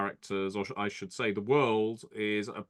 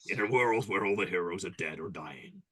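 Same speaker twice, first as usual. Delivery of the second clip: horror trailer voice. Pretend. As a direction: intone it like a trailer. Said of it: "that should be the opening to a movie".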